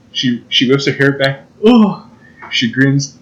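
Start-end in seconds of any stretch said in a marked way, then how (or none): 1.6-2.1 high, sudden mock swoon; hammed-up gasp of delight. Lovestruck at her grin, mock swooning. Swooning as she whips hair.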